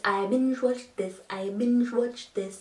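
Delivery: "binge watched" slow and fairly quiet; slow practice attempt. Shown as a demonstration. Practising a hard phrase to themselves, slow and careful. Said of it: "It's hard to say that".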